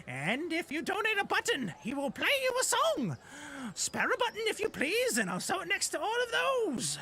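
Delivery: very high-pitched little goblin voice; ladybug goblin. Acted. Goblin begging players for a button, high squeaky pitch. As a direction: pipe up high like an eager goblin beggar. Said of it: "goblin dressed as a ladybug".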